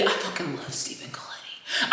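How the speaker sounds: hushed whisper. Real, not acted. Whispering her crush on Stephen Colletti.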